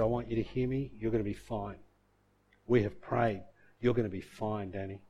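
soft, low bedside murmur; tender reassurance. Retold or imitated. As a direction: reassure him quietly, voice dropped low. Mum speaks at unconscious son's bedside.

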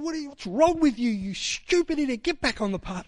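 high, shouting rebuke at a stubborn donkey; angry rebuke. Retold or imitated. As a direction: yell at the donkey to get back. Balaam yelling at his donkey.

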